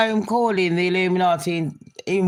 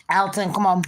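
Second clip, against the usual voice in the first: usual speaking voice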